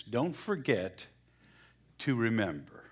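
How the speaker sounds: slow, with a pause between the halves. Shown as a demonstration. Pastor slowly breaks the sermon title apart. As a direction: say it slowly, one part at a time. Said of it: "break it down a little slower".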